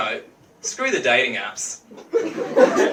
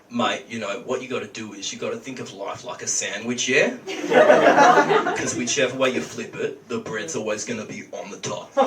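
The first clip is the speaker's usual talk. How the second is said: low, laid-back Aussie lifeguard imitation; lightly voiced surfer bloke giving wisdom; lifeguard life advice; 5 semitones lower than usual. Comic mimicking TV lifeguards giving advice, low mock bloke. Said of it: "It's always like".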